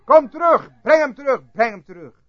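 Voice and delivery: high, loud repeated cries, easing off at the end; retold shouting. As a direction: re-enact pleading shouts that die down. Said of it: "hij schreeuwde naar mij tot zijn stem schor".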